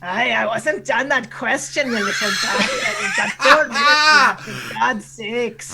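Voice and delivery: pirate scolding with a laugh; Canadian pirate. Acted. Scolding host for cutting his answer, indignant pirate voice. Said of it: "your Canadian pirate voice".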